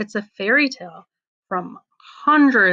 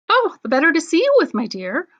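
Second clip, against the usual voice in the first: high, quick fake granny; a hint of a sly wolf playing grandma; disguised wolf; 8 semitones higher, faster than usual. Wolf in grandma's bed answering the girl, high and quick.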